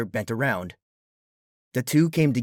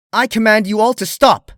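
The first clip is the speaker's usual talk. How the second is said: higher, loud royal order; commanding shout; 10 semitones higher, 10 dB louder than usual. Acted. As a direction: command them to stop at full volume. Luna ordering the creatures.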